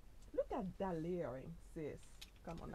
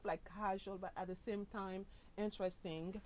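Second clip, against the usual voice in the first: normal talking voice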